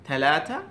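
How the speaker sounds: "থালাথা" with t-like th sounds; Middle Eastern pronunciation. Shown as a demonstration. Teacher showing how Middle Easterners say three, t-like "থালাথা". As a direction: say "থালাথা" with th as a stop, not s. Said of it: "এভাবে থা এর মতো উচ্চারণ করতেছে".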